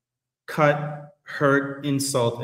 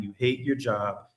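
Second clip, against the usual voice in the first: ordinary talk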